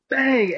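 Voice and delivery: high, sudden "bang" for a hit; imitated impact bang. Storyteller showing a friend getting clotheslined, imitated bang. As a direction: punch out a high sound effect.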